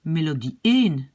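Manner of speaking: "mélodie één" with the ending read as the word één; mauvaise prononciation démontrée. Shown as a demonstration. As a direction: end "mélodie één" on one long "één", falling. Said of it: "je risque de peut-être dire". (« mélodie één », finale lue comme le mot « één »)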